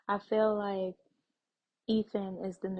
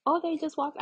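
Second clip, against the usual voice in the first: higher, louder sudden surprise; surprised gasp; 7 semitones higher, 4 dB louder than usual. Real, not acted. Reacting to characters walking in, surprised. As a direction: caught off guard by the scene. Gasps at the game scene.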